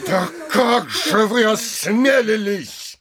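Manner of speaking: high, outraged challenge; indignant rebuke. Acted. Mayor rebuking postmaster over the opened letter, indignant. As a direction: demand it with pitch jumping up.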